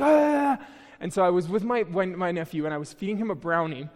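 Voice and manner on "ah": sudden high, loud alarmed "Ah!"; mock panicked yelp. Parents catching a sneezing kid, mock alarmed yelp. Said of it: "they'll be like".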